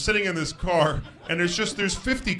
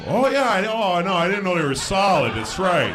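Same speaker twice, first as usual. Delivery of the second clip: higher, lightly exaggerated sudden understanding; mock realization; 5 semitones higher, 4 dB louder than usual. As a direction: pretend it has just dawned on you. Imagined driver suddenly understanding, sarcastic.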